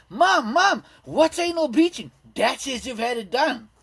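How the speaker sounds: put-on high, loud, eager kid shouting to mom; excited child yelling. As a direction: blurt it out like an excited little girl. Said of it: "my daughter jumps out the car and she goes".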